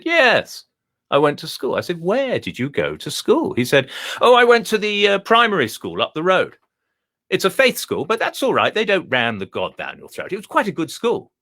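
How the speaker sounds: a high, plummy Etonian dog, pleased with itself; the dog boasts in a high, refined voice; posh dog voice. Acted. Telling the visitor about his schooling, high and plummy. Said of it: "That's why I learned to speak like this".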